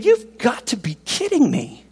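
high-pitched incredulous protest; mock disbelief. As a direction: play an appalled God, voice jumping up. Plays God appalled at offerings.

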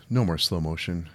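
normal talking voice

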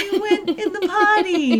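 put-on high, loud kid cheering on mom; cheering toddler voice. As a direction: squeal it like a proud little kid. Voicing a cheering three-year-old.

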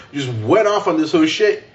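ordinary talk

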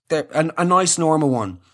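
usual speaking voice

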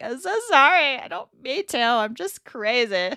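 high, loud start, giggly apology slowing at the end; retold laughing apology. Wife apologizing to her sick husband for her reflex, laughing. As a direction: imitate apologizing through giggles. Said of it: "I start laughing".

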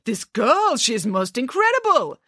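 high, mock-impressed director; pompous false admiration; sarcastic. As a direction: praise her with heavy scorn. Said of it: "said the director sarcastically".

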